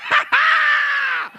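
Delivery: very high held mock squeal; long imitated squeal of excitement; freaked-out shriek. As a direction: shriek like an overexcited stranger. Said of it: "just starts freaking out".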